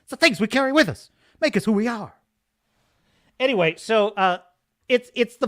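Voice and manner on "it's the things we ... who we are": higher, fast dramatic Kirk voice; Kirk quote. Retold or imitated. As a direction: quote the captain with mock gravity. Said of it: "one of Kirk's best ever speeches".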